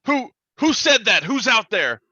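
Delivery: high, loud alarmed monster call; jumpy creature demanding who's there; startled bugbear. Acted. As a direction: shout into the dark, spooked. Bugbear hears voice.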